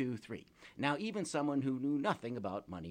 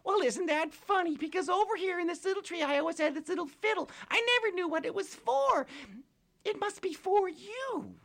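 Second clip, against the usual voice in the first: tiny pixie voice; 8 dB louder than usual. Acted. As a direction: squeak like a tiny tearful fairy. Held pixie voice.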